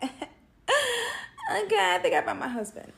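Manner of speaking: high, excited squeal of delight; giddy excitement. Retold or imitated. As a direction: gush like you just found the one. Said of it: "So I'm just like".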